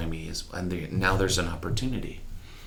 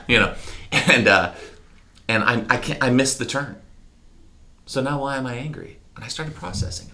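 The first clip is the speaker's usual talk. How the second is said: normal talking voice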